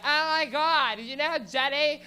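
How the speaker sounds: high, slow, loud gushing phone chatter; valley girl on phone. Retold or imitated. Comic mocking a loud girl on her phone, high gushing voice. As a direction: drag out know like a gossiping girl. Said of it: "The girl on her phone is like".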